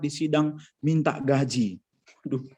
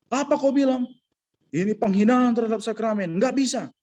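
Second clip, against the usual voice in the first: high-pitched, indignant retort as the priest; mock outrage of a scandalised priest; retold angry priest; 8 semitones higher than usual. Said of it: "lalu pastor marah". (pastor yang tersinggung, menirukan bentakan dengan nada tinggi)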